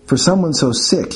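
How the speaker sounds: plain reading voice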